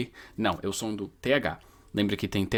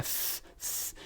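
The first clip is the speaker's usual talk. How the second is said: whispered th; unvoiced th; 17 dB quieter than usual. Shown as a demonstration. Teacher showing the unvoiced th. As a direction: breathe out th, no voice. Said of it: "fazendo o som do T H".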